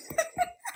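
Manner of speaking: high burst of giggles; real giggle. Real, not acted. Streamer breaking off her story, high giggling. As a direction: crack up mid-word.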